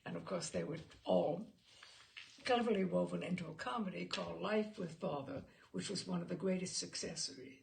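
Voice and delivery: ordinary delivery, nothing marked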